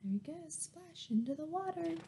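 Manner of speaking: ordinary delivery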